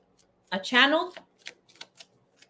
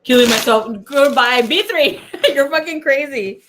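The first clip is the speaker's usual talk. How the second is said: loud, higher, breaking into laughter; laughing, high-energy exclamation; amused outburst; 5 semitones higher, 9 dB louder than usual. Real, not acted. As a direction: exclaim it loudly, cracking up. Amused reaction to viewer comment.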